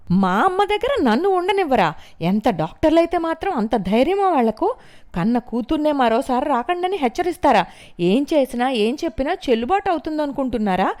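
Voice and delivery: high, loud furious tirade; aggressive ranting. A narrator's reading. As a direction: rant at the doctors. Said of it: "దూకుడుగా అలా అరుస్తున్నట్లుగా మాట్లాడుతూ".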